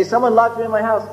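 trapped man's complaint. Retold or imitated.

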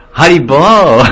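slow, swooping drawn-out greeting; mock smitten Indian merchant's hello; interested shopkeeper. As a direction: stretch it out with teasing interest. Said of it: "he's, like, a little interested".

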